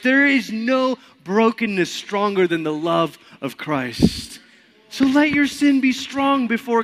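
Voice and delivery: high, loud, fervent preaching; preaching at full force. Acted. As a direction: preach it out with everything.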